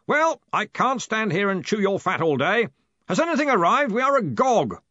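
high, hearty, impatient holler; brash overbearing boss. A narrator's reading. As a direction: boom on without listening.

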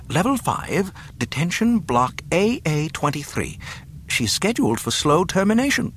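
high-pitched droid report; translating droid. A narrator's reading. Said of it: "while three P O translated".

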